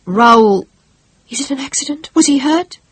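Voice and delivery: high, anxious questions; panicked about the groom; alarmed. Acted. Bride fears for groom.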